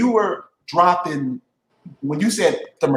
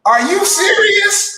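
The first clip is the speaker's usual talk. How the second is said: amazed; 6 dB louder, slower than usual. Real, not acted. Reacting to court-ordered six months.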